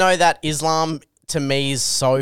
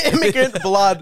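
laughing, pitched up; laughing mock pronouncement; 5 semitones higher than usual. Real, not acted. Friends riffing on fighter's background, laughing. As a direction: chuckle it like a catchphrase.

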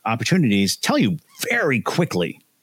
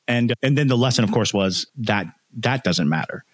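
ordinary talk, nothing marked

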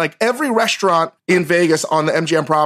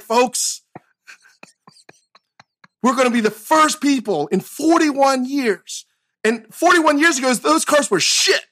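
high, rousing mock rally speech to the fans; Braveheart speech; 7 semitones higher than usual. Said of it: "a Braveheart, like, speech".

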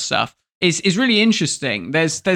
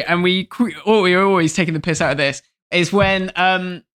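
ordinary delivery

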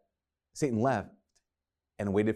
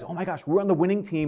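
higher, excited mimicry of the disciples; excited apostles; 8 semitones higher, 4 dB louder than usual. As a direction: act out their thrilled realization. Apostles realize they are winning.

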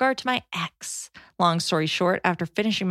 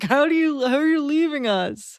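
higher-pitched mock protest; dismayed parents; 6 semitones higher than usual. Parents to son moving twelve hours away, mock dismay. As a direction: act out wounded, disbelieving parents.

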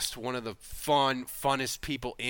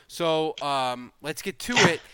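normal talking voice

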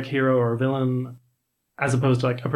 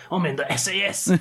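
gruff squaddie impression; lightly voiced tough soldier; 4 semitones higher than usual. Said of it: "He's like".

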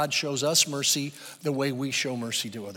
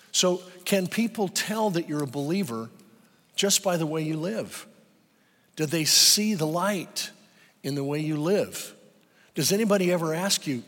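ordinary delivery

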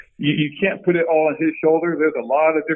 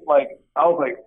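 normal talking voice

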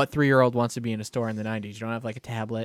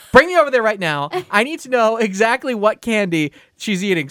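louder, higher demanding grandma; urgent grandmother; 8 semitones higher, 6 dB louder than usual. Retold or imitated. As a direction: insist like an alarmed grandmother. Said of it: "She goes".

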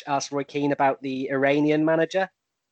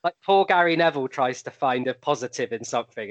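ordinary talk, nothing marked

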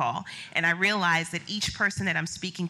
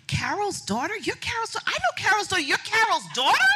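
high, rising, surprised recognition; surprised Oprah; 14 semitones higher, 3 dB louder than usual. Retold or imitated. Oprah recognizing the brand on screen, high surprised delight. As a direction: realize it mid-sentence, delighted. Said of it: "and she's like".

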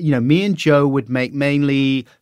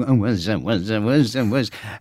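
[name] impression. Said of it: "the impressions would just devolve into".

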